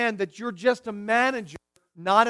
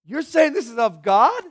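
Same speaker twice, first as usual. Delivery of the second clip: indignant wife, higher and louder; wife's objection; 4 semitones higher, 6 dB louder than usual. Retold or imitated. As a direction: push back as the hurt wife who did the work. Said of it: "She said".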